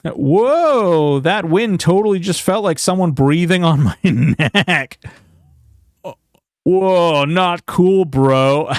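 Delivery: high creeped-out whoa; spooked, half laughing. Real, not acted. Creeped out by wind.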